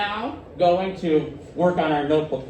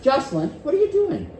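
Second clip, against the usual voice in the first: bright high curious question; friendly raised-pitch query; 10 semitones higher than usual. Shown as a demonstration. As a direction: ask it lightly and openly. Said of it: "I used exactly the same words".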